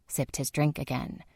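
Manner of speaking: plain reading voice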